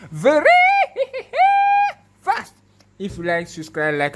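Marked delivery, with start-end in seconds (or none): 0.1-2.5 high and loud, breaking into a laugh; laughing with excitement. Real, not acted. Showing off the fast autofocus, words breaking into a thrilled laugh.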